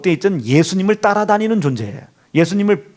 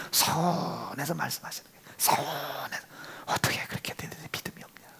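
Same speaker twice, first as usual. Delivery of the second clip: fast hushed whisper; whispered, quick and secretive; 4 semitones lower, 16 dB quieter than usual. Acted. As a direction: rush it out in a whisper. Hushed retelling of Jesus' words.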